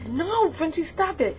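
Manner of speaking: high, pleading reprimand; mock scolding. Telling Frenchie to stop, high mock scolding. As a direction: rein in someone misbehaving, voice up high.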